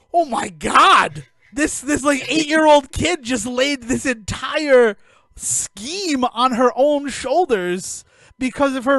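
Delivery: loud, slow, emotional exclaim; overwhelmed outburst. Real, not acted. Recalling scene that made him cry.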